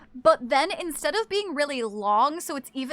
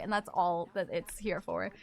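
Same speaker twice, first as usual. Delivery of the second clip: ordinary delivery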